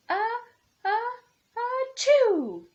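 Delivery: a huge, high, loud cartoon sneeze building up; the fish's mighty sneeze, high and loud; big sneeze. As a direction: wind up and blast out a giant sneeze.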